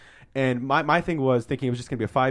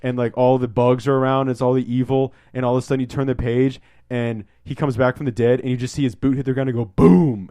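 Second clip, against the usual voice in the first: Chris Farley impression; 2 semitones higher, 5 dB louder than usual. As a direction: blurt it out like a giddy comedian fanboy, building to a loud boom. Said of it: "I turn on the Chris Farley from SNL and I go".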